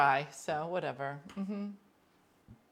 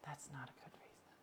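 soft breathy whisper; whispered aside; 2 semitones lower, 21 dB quieter than usual. Real, not acted. Commenting on pregnancy as an excuse, whispered. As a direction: murmur it as an aside.

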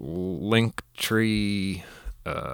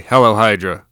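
loud announcer voice; 3 semitones higher, 12 dB louder than usual. Real, not acted. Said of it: "I don't know why I'm saying it like that".

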